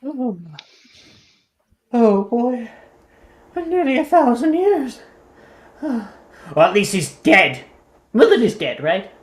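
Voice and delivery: lightly voiced prim old owl; a hint of a haughty old owl; fussy old owl. GM plays owl Lord Pendleton.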